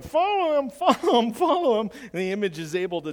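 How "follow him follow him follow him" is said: high, loud squeaky puppet voice; ventriloquist dummy voice. Retold or imitated. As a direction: chirp it like a talking dummy, laughing through. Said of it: "with an image that's going".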